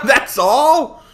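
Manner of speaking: high, loud, through laughter; laughing disbelief. Real, not acted. Reacting to the new execution, laughing high disbelief. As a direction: squeeze the question out while laughing.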